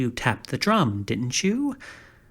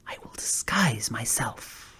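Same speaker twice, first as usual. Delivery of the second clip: hushed, secretive whisper; 3 semitones lower, 6 dB quieter than usual. A narrator's reading. Scarecrow plotting a disguise to the punster, whispered. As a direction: drop to a sly whisper.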